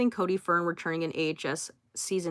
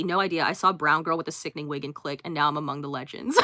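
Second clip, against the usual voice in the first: ordinary delivery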